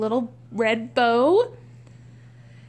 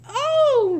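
high, loud burst of delight; real high-pitched excitement; delighted squeal; 14 semitones higher, 6 dB louder than usual. Real, not acted. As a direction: squeal a thrilled "oh". Delighted at a gift outfit.

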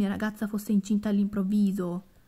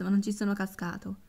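ordinary talk, nothing marked

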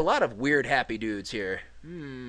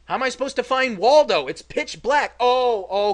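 suddenly higher and louder, thrown by the dark; surprised exclamation; 8 semitones higher, 10 dB louder than usual. Real, not acted. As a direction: blurt it out, caught off guard. Confused by a dark game screen.

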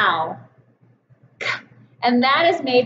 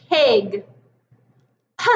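usual speaking voice

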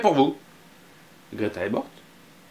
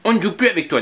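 put-on higher, louder scolding girl; huffy girl; 8 semitones higher, 4 dB louder, faster than usual. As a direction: shut him out, indignant. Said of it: "dit Greta".

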